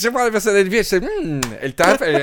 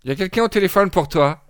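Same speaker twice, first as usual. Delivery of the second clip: calling out; 6 semitones higher, 7 dB louder than usual. Retold or imitated. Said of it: "les parents qui disent".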